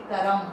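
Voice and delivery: करम with a full vowel after क; full r demo. Shown as a demonstration. Teacher shows the wrong full-r करम. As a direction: say करम with the र fully voiced. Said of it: "अगर हम र पूरा बोलेंगे".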